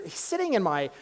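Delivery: usual speaking voice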